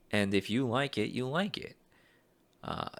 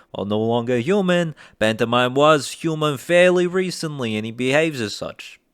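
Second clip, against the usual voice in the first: loud, raised East Coast accent; 4 semitones higher, 9 dB louder than usual. Shown as a demonstration. Actor trying out the Pantomime role, loud East Coast accent. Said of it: "or other East Coast accent".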